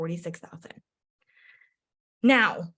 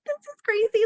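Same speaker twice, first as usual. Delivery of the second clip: much higher, thrilled exclamation; overjoyed disbelief; excited squeal; 14 semitones higher, 4 dB louder than usual. Retold or imitated. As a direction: squeal with delight. Friend just went elite.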